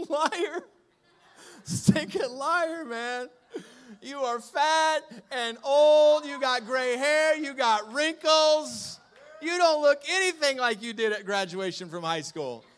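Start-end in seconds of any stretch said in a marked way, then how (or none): 0.1-3.3 loud, slow playful call of liar; hammed-up finger-pointing at a liar; mock accusation. Calling out the lie. 4.0-11.2 loud, slow mock-blunt truth telling; hammed-up brutal honesty, drawn out; teasing bluntness. Blunt truth behind the lie.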